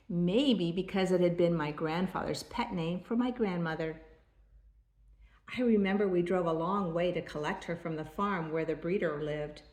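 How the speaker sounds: ordinary delivery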